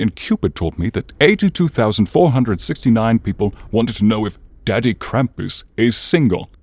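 ordinary talk

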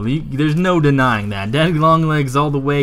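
ordinary delivery

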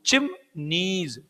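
चिमनीज broken into चिम, then a long नीज; syllable counting demo. Shown as a demonstration. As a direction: slowly separate the two syllables of चिमनीज. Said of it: "बताओ कितनी है".

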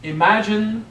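ordinary talk, nothing marked

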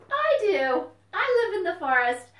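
high, loud, excited puppet voice; a bouncy little puppet popping up; puppet fox voice. Acted. Puppet fox greets the children, high and loud.

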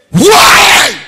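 one high, huge shouted Why. Acted. Preacher shouting at husbands who won't share. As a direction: hurl the word at the crowd.

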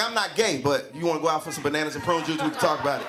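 low, smooth comic turnaround; sly, low-pitched relenting; coy backtracking. Acted. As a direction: drop low and sound open to offers. Same denial softens into accepting invitation.

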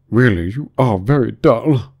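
slow, loud scolding; angry. A narrator's reading. Mock Turtle snubbing Alice, loud, slow and angry. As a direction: scold with heavy, drawn-out words. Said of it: "said the Mock Turtle angrily".

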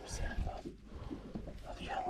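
hushed whisper under the breath; whispered prayer. Real, not acted. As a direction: whisper the prayer so the fish won't hear. Reeling in a fish, praying under breath.

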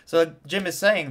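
normal talking voice

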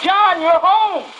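high, loud, slow cry of joy; mother sees her son at the threshold, crying out; cries out. Retold or imitated. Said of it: "from the third person to the second person".

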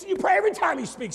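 loud, high-pitched preaching burst; emphatic punchline. Real, not acted. Preacher urging prayer whenever Biden speaks, emphatic shout. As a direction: push the joke over the top.

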